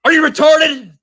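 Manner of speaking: high, loud mock outrage; mock yell. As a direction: blurt the quote out loud. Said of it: "B Barrett for two says".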